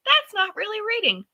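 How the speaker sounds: high-pitched mocking quote; mocking naysayer. Scoffer dismissing audiobooks, mocked in a high voice. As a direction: imitate a scoffer in a squeaky high voice. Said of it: "for anybody who's like".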